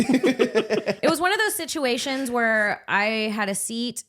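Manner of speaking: laughing. Real, not acted. Laughing start of airport story.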